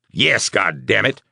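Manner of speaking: loud angry snarl. Acted. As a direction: snarl it through the pain. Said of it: "Guthrie snarled".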